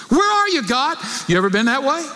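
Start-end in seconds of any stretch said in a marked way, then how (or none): 0.1-1.0 angry cry to God. Shown as a demonstration. Preacher acting the prophet angry at God. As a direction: shout it up at heaven, frustrated. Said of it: "He's angry".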